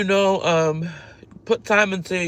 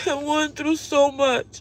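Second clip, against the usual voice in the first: tearful; 6 semitones higher, 3 dB quieter, slower than usual. Real, not acted. Breaks down mid-story.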